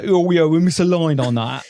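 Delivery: exaggerated South London accent; mock a broad South London accent; put-on South Londoner voice. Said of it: "sound like I'm some South Londoner".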